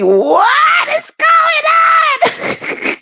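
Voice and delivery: very high, long-drawn squawk; comic shriek. Pretend. Baffled by Orr Lee's name, high drawn-out shriek. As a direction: stretch every word out in a high wail.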